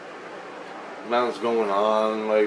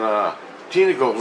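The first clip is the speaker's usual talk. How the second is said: usual speaking voice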